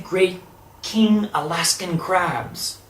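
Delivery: usual speaking voice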